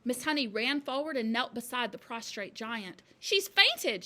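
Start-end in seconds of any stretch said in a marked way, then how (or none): 3.2-4.0 high, loud, alarmed cry; cried out. A narrator's reading. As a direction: raise the alarm. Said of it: "she cried".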